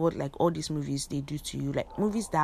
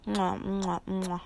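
smacking mwah kisses into the mic; three quick air kisses; blown kisses; 3 semitones higher, slower than usual. Real, not acted. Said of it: "you guys get kisses".

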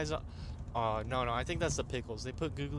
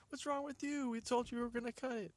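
very high-pitched mock indignation; retold exasperated comeback; 11 semitones higher than usual. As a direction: throw the complaint back, stressing YOU.

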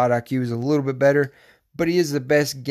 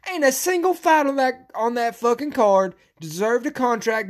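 outraged high-pitched yelling, punching the swear words; yelled rant; 7 semitones higher than usual. Real, not acted. Podcaster berating Dana over the denied contract, angry yelling. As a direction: rant indignantly, pitched well above normal talk. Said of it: "Sorry about yelling".

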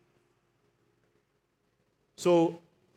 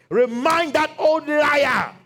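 slow, high, loud taunt at the devil; taunting shout; 8 semitones higher, 5 dB louder, much slower than usual. Acted. Preacher taunting the devil, slow loud shout. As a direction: drag it out, mocking the liar.

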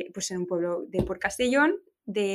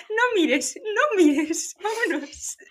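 high laughing urgent mother; hurried mock mom; 11 semitones higher, much slower than usual. Mother covering daughter's eyes on the beach, high and laughing. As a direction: rush the child away while laughing.

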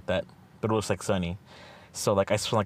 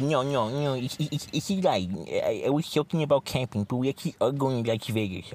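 higher-pitched, sing-song insistence; high mock friend voice; 5 semitones higher than usual. Friend keeps teasing him about Vegas in a high mocking voice. Friend admits the camping joke.

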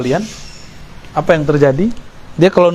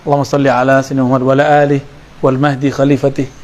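very fast, lower prayer formula; rattled-off prayer; 3 semitones lower, much faster than usual. Shown as a demonstration. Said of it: "kalau sholawat pasti ada tambahan". (sholawat dibaca sangat cepat)

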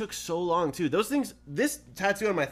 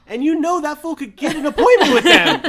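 high, loud, laughing through the words; 10 semitones higher, 9 dB louder than usual. Real, not acted. Friend laughing about the star's tattoo connections, loud. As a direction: laugh while making the point.

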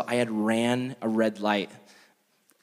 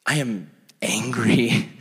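slow whispered fury; seething whisper; 3 semitones lower, much slower than usual. Retold or imitated. Pastor fuming in the grocery store, slow seething whisper. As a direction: hiss it under your breath.

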